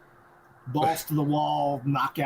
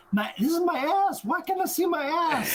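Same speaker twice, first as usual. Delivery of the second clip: much higher, fast, cartoonish bafflement; mock baffled Helen; faster than usual. Helen with her head on backwards, much higher mock bafflement. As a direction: squeal it like a confused cartoon.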